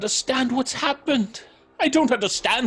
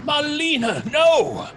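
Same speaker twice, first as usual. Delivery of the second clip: higher and louder alarmed call; 5 semitones higher, 3 dB louder than usual. Acted. Edmund calling to Marlena on the scaffold, alarmed. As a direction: cry out to stop her.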